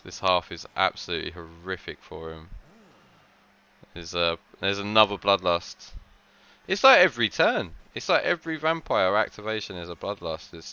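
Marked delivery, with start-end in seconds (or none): none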